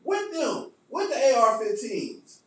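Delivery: high, loud taunt that fades out; mock yell at the protesters; mock taunting shout. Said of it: "Now, I say".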